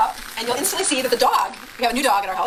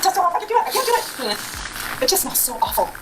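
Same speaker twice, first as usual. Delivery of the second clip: distressed; 7 semitones higher, faster than usual. Real, not acted. Gagging, can't eat liver.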